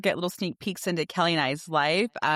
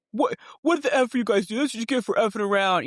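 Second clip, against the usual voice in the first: gruff dad; 6 semitones higher, 4 dB louder, faster than usual. Retold or imitated. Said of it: "Justin is like".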